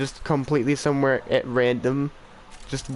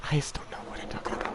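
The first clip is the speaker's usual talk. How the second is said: laughing whisper; 10 dB quieter, faster than usual. Real, not acted. Laughing at himself.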